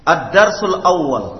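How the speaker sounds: loud, clear, deliberate Arabic vocabulary reading; model reading drill. Shown as a demonstration. Teacher modelling Arabic words for students, loud and clear. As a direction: read each phrase out crisply for the class to copy. Teacher leads class repetition.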